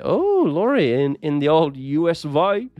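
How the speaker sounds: high, hammed-up American accent; exaggerated mock-American with a loud opening; bad American accent. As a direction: put on a badly faked American voice. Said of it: "that was a really bad accent".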